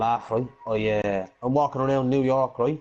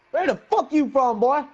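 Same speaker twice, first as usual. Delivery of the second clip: mock tough Harlem kid; 11 semitones higher, 3 dB louder, slower than usual. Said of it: "he turned around and he says".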